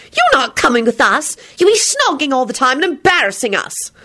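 put-on high, outraged girl's protest; appalled girlish complaint; shrieking protest. A narrator's reading. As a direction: object hotly, voice pitched high. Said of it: "Rosie shrieked".

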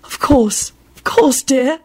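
a hint of a dreamy, absent old lady; vague murmur. A narrator's reading. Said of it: "Sybil murmured vaguely".

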